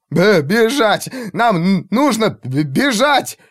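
high, desperate stuttering cry; panicked stammer. A narrator's reading. Terrified mage urges everyone to flee, stammering. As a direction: stumble over every word, begging to run.

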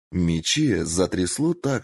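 plain reading voice

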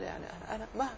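soft, weary mumble; mildly imitating an exhausted student; a quietly imitated tired old man. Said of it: "بعد أن تعب قال".

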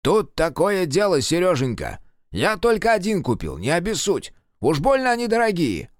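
sheepish grandpa excuse. A narrator's reading.

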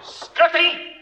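high, loud furious bark; furious shout. Acted. Satin shouts down the whole room in fury, high and loud. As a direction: roar them into silence.

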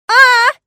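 very high, loud shriek of alarm; alarmed cry. A narrator's reading. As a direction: shriek at the sight of the monster. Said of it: "воскликнули остальные". (тревожный визг)

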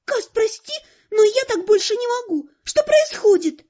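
put-on high, plaintive girl's voice; pleading girl. A narrator's reading. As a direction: plead for an explanation, high and upset. Heroine pleads with her boyfriend. (жалобно, высоким девичьим голосом)

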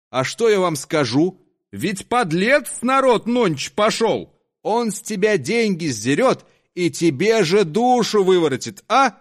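put-on loud, high drunken rant; drunk's harangue. Drunk to the crowd, stirring them up, loud rant. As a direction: rouse the crowd like a tipsy rabble-rouser.

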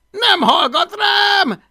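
high, loud, drawn-out protest; desperate shouting back. Acted. Boy tells man dragon won't listen, shouting. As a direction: yell back over the chaos.

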